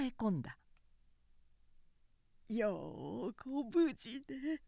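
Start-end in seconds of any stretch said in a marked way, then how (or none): 2.5-4.6 slow, soft and teary; an old woman holding back tears; frail mother near tears; tearful old mother. A narrator's reading. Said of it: "老母はすぐ涙ぐむ".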